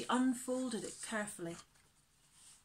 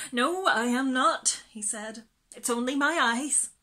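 tearful denial; 4 semitones higher, 9 dB louder than usual. Acted. Boy denies crying.

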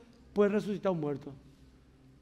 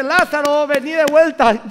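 loud call, louder still on vení de vuelta; Jesus calling Lazarus; 6 semitones higher, 13 dB louder, slower than usual. Retold or imitated. Jesus calling Lazarus back from heaven, loud summons. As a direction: summon him back from the dead. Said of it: "Y Jesús le dice".

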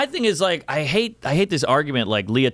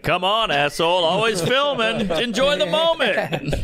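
annoyed bystander; 5 semitones higher, slower than usual. Retold or imitated. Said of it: "they were like".

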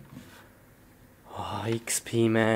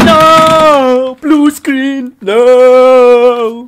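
very loud, high, long wailed cries; screaming in despair; 10 semitones higher, 14 dB louder, much slower than usual. Acted. Gamer seeing the computer crash, screaming in despair. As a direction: howl it like total catastrophe. Computer shows blue screen.

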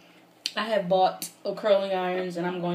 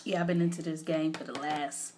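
normal talking voice

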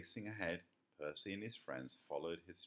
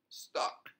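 muffled fox voice; 8 dB louder than usual. A narrator's reading. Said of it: "said the fox".

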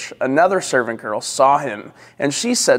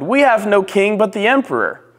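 high-pitched, emphatic declaration; insistent chief priest; 7 semitones higher than usual. A narrator's reading. Chief priests reply to Pilate.

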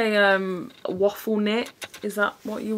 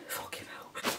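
breathless whispered swear; strained whispered curse; 17 dB quieter than usual. Real, not acted. Wrestling into a tight top, cursing in a whisper. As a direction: hiss it under your breath.

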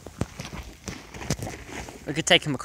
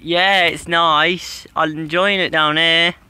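higher, louder, slow dog voice; voicing the dog; 5 semitones higher, 6 dB louder, slower than usual. Owner voicing the paddling dog, pleased and slow. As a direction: give the dog a happy, unhurried voice. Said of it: "he says".

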